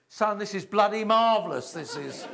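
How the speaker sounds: emphatic father's praise. Retold or imitated. Father praising his son in the garden, loud and hearty. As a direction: boom out the dad's delight.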